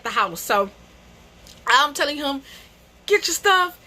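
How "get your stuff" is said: high furious shouting; going off. Retold or imitated. Ordering her cheating boyfriend out of the house, shouting high. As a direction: throw him out, yelling high. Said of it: "you know, going off".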